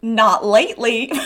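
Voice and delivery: put-on heavy southern accent, falling at the end; hammed-up southern accent; stronger southern accent. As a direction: lean further into a southern accent. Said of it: "lean even more into a southern accent".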